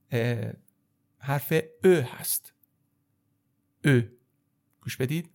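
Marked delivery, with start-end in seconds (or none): 1.8-1.9 "ا" as French eu, from the throat; rounded French vowel. Shown as a demonstration. Teacher introducing the letter, throaty rounded "ا". 3.8-4.0 "ا" as French eu, from the throat; rounded French vowel. Shown as a demonstration. Teacher introducing the letter, throaty rounded "ا".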